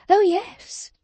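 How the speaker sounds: high, fluttering yes; a breathy coo of agreement; gushing Mrs Lammle. A narrator's reading. Mrs Lammle answering Fledgeby, high and fluttering.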